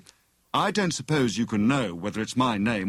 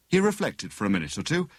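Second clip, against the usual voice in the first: plain reading voice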